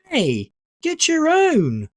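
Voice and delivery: high, slow indignant protest; indignant little brother. A narrator's reading. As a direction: scold a sausage thief, outraged. Brother steals his sausage.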